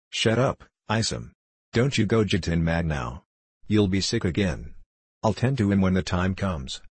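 ordinary delivery, nothing marked

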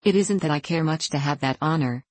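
ordinary delivery, nothing marked

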